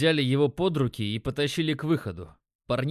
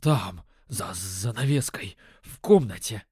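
trembling mumble; 5 semitones lower than usual. A narrator's reading. Pinned addict giving up the boss's location, trembling mumble. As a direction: stammer it out haltingly, shaking with fear. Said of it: "пробормотал он, его голос дрожал".

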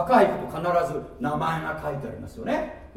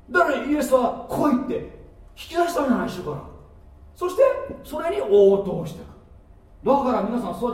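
loud, high, urgent sermon voice; preaching at full force; 7 semitones higher, 7 dB louder than usual. Acted. Preacher urging the congregation to reach out and touch the Lord, fervent and loud. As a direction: drive the point home to the crowd.

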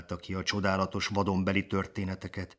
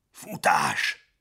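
a louder, lower alarmed cry to run; frightened shout; 5 semitones lower, 4 dB louder than usual. A narrator's reading. Father lion warning his son of the herd, frightened shout. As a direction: yell the warning in fear. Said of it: "kiáltott fel Sámson rémülten".

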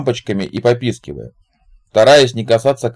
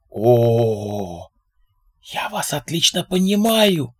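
conspiratorial drawl; 3 semitones higher, 3 dB quieter, much slower than usual. A narrator's reading. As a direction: draw it out like a fellow plotter. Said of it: "заговорчески протянул Зиг".

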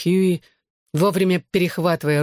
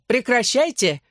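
higher-pitched mild shout; calling out; 8 semitones higher, slower than usual. A narrator's reading. Barmaid tells brawlers to stop, mildly shouted. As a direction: call a halt, pitch up, volume barely raised. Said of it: "крикнула нам барменша".

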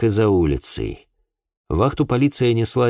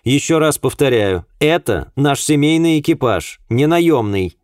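insistent, higher-pitched rebuke; sharp, raised-pitch correction; curt, annoyed woman; 7 semitones higher, 4 dB louder than usual. A narrator's reading. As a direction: spell it out with irritation. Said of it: "отрезала миссис Гриффитс".

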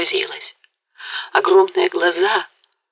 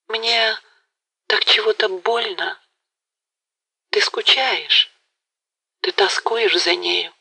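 higher and slow, halting with hurt; weak, faltering voice; 4 semitones higher than usual. A narrator's reading. Galina confesses her jealousy to Mazepa, weak and faltering. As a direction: speak as if it pains her to say it. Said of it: "слабым, прерывающимся голосом".

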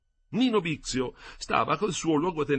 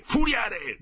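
much higher, louder yell for the quartermaster; furious second call, louder than the first; shouting; 12 semitones higher, 5 dB louder than usual. A narrator's reading. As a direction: bellow the name at full voice, higher. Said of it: "gridò Bixio".

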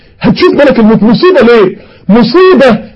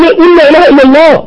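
urgent pleading; 5 semitones higher than usual. Retold or imitated. A passenger pleading with the dying man, higher and urgent. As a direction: beg him to repeat the words.

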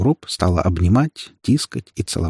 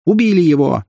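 high, louder accusing cry; loud, almost shouting; 7 semitones higher, 5 dB louder than usual. A narrator's reading. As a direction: cry it out for the crowd. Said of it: "она говорила громко, почти кричала". (громко, почти крича)

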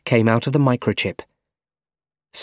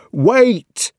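sudden high, loud cry to stop someone; shouting; 12 semitones higher, 5 dB louder than usual. A narrator's reading. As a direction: shout it urgently, high-pitched. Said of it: "shouted Otto".

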